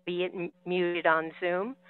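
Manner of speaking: normal talking voice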